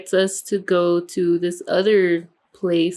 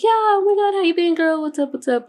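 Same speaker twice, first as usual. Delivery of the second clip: excited gushing greeting; 10 semitones higher than usual. Retold or imitated. Old friend greets narrator at the bar, high excited gush. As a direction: gush like you just found your long-lost friend.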